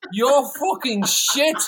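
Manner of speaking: loud, high, very slow mock insult. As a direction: draw out every word like a scornful British rocker. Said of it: "such a good fucking impersonation of them".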